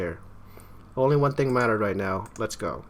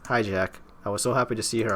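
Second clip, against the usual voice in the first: ordinary talk, nothing marked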